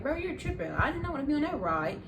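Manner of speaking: indignant, pitched high; retold cussing out. Rider to ride operator after getting off, retold indignation. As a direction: tell him off in high-pitched protest. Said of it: "I started cussing him out".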